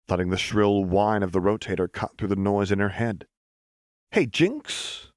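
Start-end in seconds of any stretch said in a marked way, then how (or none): 4.1-5.1 high, bright hail across the room; calling out. Acted. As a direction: call her name cheerfully. Echo calling out.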